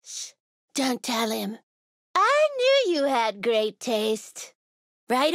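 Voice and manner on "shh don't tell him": low-pitched, hushed secret; conspiratorial. Acted. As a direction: shush them, then confide in a lower voice. Playful secret being shared.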